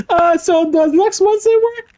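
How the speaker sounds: very high, squeaky, meek little voice; squeaky timid voice. Acted. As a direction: squeak it out like a nervous clerk. Voicing Harry's email reply.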